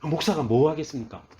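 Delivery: ordinary delivery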